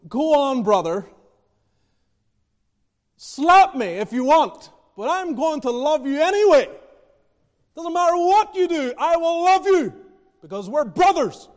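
loud, high, pleading cry of brotherly love; preacher voicing a fellow pastor's fervent vow; loud fervent quote. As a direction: call it out with fervent brotherly devotion. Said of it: "he was basically saying".

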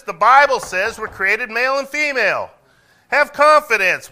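loud, high-pitched pulpit proclamation; impassioned preaching. Real, not acted. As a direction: preach with full-force conviction. Preaches at full force.

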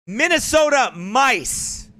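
"Minnesota mice" slowly, each word weighted; slow emphasis. Shown as a demonstration. Coining a term for viewers, slow and deliberate. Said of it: "again, let me quote that".

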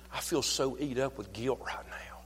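hushed, guilty whisper; soft whisper of guilt; whispered confession. Acted. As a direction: confess it under your breath. Voicing a guilty believer's confession.

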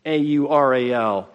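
"A U R A L" spelled letter by letter. Shown as a demonstration. Preacher spells the word for his hearers, letter by letter. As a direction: spell out "A U R A L", one letter at a time. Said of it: "fourth, aural".

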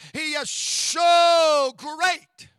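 "so" drawn out slow and loud; stretched so. Shown as a demonstration. Pastor stressing to the congregation how great God is, long loud "so". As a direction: lean hard on "so", stretching it. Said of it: "God is not just great".

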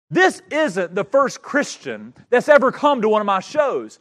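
furious preacher's retelling; retold angry outburst. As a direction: bellow it like the angry magician. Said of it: "he got angry".

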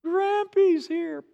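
put-on high, loud, drawn-out little-kid holler; hollering grandkids. Grandkids running to greet their grandparents, hollering. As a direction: imitate excited grandkids calling out. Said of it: "just hollering out".

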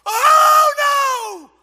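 high loud wail of shock; child crying out at the news of death, high and loud; horrified cry. Acted. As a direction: cry out in horror.

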